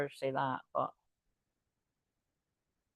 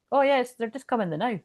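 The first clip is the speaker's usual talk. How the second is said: lilting Scottish "coming the now", raised pitch; Scottish accent; 5 semitones higher than usual. Retold or imitated. Reader imitating hometown locals in Scottish accent. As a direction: mimic a hometown Scot, chatty. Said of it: "where I'm from, we'll be like".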